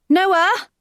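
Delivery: loud, high call into the dark. A narrator's reading. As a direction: call his name across the grove. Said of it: "Rachel calls out".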